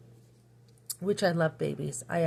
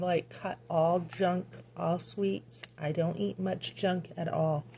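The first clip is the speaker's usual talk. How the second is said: ordinary delivery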